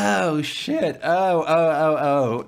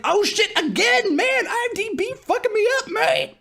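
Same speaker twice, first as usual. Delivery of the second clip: excited outburst. Retold or imitated. Retelling his reaction to the actor's return, very high delighted cry. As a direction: squeal out surprised joy. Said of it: "I was like".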